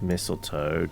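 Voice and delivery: ordinary talk, nothing marked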